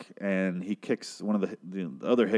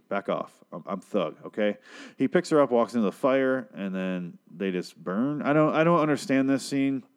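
normal talking voice

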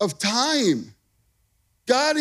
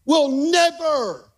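"we'll never" slow, higher and louder, leaning on "never"; stress on never; 6 semitones higher, 4 dB louder, slower than usual. Shown as a demonstration. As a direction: drag out "never" like throwing down the gauntlet. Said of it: "I love that word".

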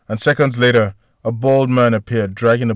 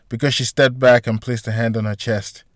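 plain reading voice

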